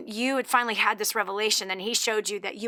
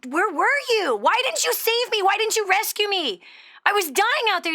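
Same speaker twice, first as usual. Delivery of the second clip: high, loud string of accusing questions; hammed-up wounded complaint; acted indignant reproach; 11 semitones higher, 4 dB louder than usual. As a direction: confront God in mock outrage. Joke's man confronts God.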